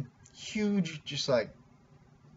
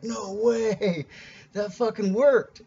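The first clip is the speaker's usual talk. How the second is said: higher, drawn-out retold amazement; amazed disbelief; 7 semitones higher, 5 dB louder, much slower than usual. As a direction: relive the astonishment, pitched up and getting louder. Retelling his amazement that it worked.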